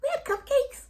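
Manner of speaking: put-on high bright little-girl voice, proud and eager; childlike proud voice. Daughter proudly telling Mommy she ate cupcakes, squeaky child voice. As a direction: boast like a small child caught out. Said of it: "I said".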